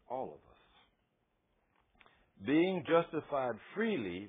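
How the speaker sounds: slow, weighty scripture quote; scripture reading. Acted. Preacher to congregation, solemn scripture reading. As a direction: read the verse with solemn weight.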